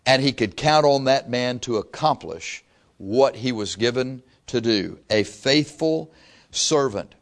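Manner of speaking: ordinary talk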